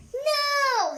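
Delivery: high loud protest; rhino's booming no. Acted. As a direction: shout the no to stop them. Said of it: "he said".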